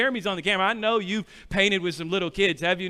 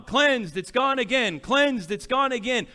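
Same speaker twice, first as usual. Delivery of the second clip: ordinary talk, nothing marked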